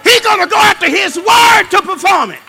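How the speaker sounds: loud, high shouted preaching; preaching at full force. Acted.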